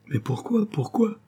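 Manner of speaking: high, pleading question; exclaiming. A narrator's reading. Daughter baffled by her mother's pity, exclaiming. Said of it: "s'écria Geneviève".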